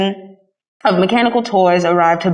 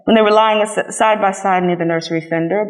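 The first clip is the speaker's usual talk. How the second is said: usual speaking voice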